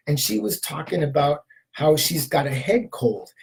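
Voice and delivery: normal talking voice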